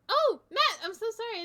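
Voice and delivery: high, loud burst of apology; surprised apology. Real, not acted. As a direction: exclaim as if just noticing, then apologise. Suddenly notices a viewer's host.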